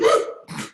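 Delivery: sharp unvoiced hiccup gasp; a startled hiccup catching the breath; sudden hiccup. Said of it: "Well, they're back".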